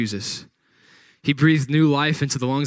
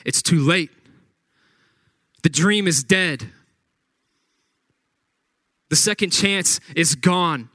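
raised, pressing voice of doubt; voice of despair; 3 semitones higher than usual. Retold or imitated. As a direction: let inner doubt nag and push. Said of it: "everything in your head says".

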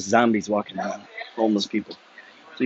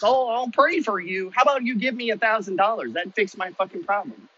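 mocking prayer offer; 8 semitones higher than usual. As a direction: parody a sweet empty promise. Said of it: "Help them with your good words?".

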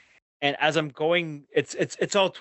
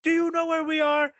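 higher, angry retold yell; yelling at the kids; 10 semitones higher than usual. Dad losing it at his arguing kids in the car, angry yell. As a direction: snap at the squabbling kids in the back. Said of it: "so I'm just going off".

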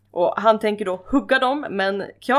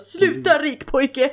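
mock angry snap; 6 semitones higher, much slower than usual. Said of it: "tar han tag i Dysons krage".

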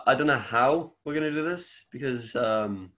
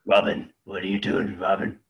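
Batman voice; 5 semitones lower than usual. Retold or imitated. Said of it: "doing a Batman impression".

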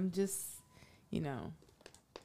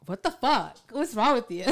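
higher, louder imitation of an annoyed friend, laugh creeping in; mock angry retort; 5 semitones higher, 10 dB louder than usual. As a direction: snap it back in mock outrage. Acts out her angry retort.

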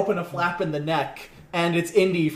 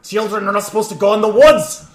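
angry shout; 6 semitones higher, 12 dB louder than usual. Acted. GM voices angry cabin man.

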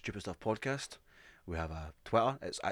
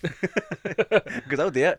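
slow laughing warning; laughing threat; 8 semitones higher, 10 dB louder, much slower than usual. Pretend.

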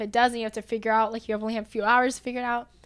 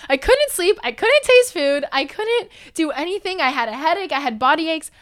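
loud, emphatic, high-pitched list of complaints; outraged realisation; 4 semitones higher, 11 dB louder than usual. Retold or imitated. Daughter realising covid explains her symptoms, outraged realisation. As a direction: hit each symptom hard, as if it finally clicks.

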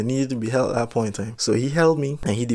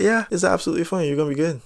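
normal talking voice

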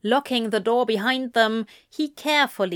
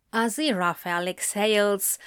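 plain reading voice

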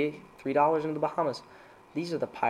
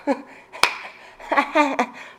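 loud high laugh; 13 semitones higher, 7 dB louder than usual. Real, not acted. Laughing at own joke.

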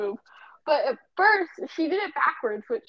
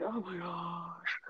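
slow, low, quiet exasperated groan; 5 semitones lower, 12 dB quieter, much slower than usual. Retold or imitated. Rolling her eyes at the friend's text, slow and fed up. Reacting to "take it easy" text.

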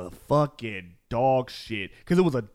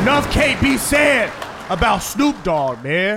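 drawn-out hyped praise, louder and higher; loud hype; 7 semitones higher, 5 dB louder, slower than usual. Real, not acted. Hyping [name] to his friends, loud and slow.

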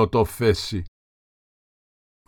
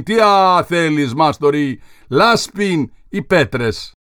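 higher, slow, drawn-out mock Karpathian accent; 5 semitones higher, 4 dB louder, much slower than usual. Apprentice mocking the mason, drawn-out Karpathian accent. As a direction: ape the mason's Karpathian accent, dragging the words. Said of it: "επισύρων, ως εκείνος τη φωνή".

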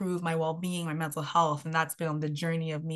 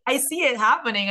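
put-on insistent young dreamer; 7 semitones higher, 7 dB louder than usual. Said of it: "And I was like".

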